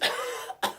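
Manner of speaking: acted cough, as if expelling water; mock spewing cough. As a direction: cough it out like someone being resuscitated. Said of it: "you spew out doubt".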